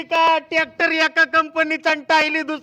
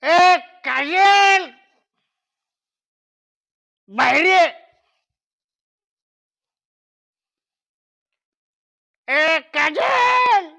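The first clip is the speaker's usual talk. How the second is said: long drawn-out, raised holler; calling out; 4 semitones higher, much slower than usual. Acted. Drunk husband summons wife from outside, drawn-out call. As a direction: call her out of the house, stretching the name.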